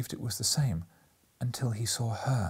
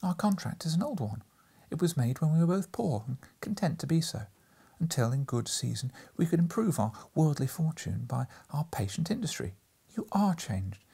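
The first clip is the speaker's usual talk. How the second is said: higher, sorrowful voice; sorrowful young woman; 7 semitones higher than usual. A narrator's reading. Girl recalls their old betrothal to him, higher and sorrowful. Girl's voice in story.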